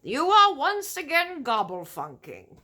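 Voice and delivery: loud scolding giant; giant shouting. A narrator's reading. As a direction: bark it out as a cross giant. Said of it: "the giant shouted".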